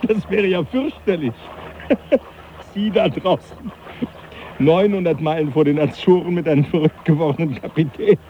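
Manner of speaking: tipsy professor chuckling through his words; laughing merrily. Acted. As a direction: relish the grim joke while laughing. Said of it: "Sie sind offenbar in sehr heiterer Laune".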